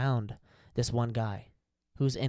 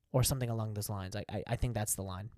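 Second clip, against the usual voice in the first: normal talking voice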